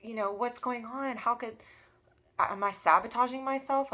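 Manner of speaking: retold frustrated outburst; upset disbelief. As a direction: demand answers from yourself, upset. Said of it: "I was sad. I'm like".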